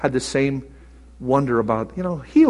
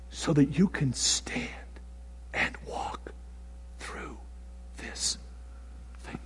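much softer, slow hushed whisper; 4 semitones lower, 16 dB quieter, slower than usual. Real, not acted. God's promise to stand firm, slow whisper. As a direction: breathe the words out.

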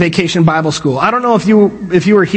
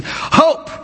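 high, puffed-out HOPE; breathy, high-pitched cheer of HOPE; breathy exclamation; 8 semitones higher, 4 dB quieter than usual. Shown as a demonstration. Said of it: "the little breath thing".

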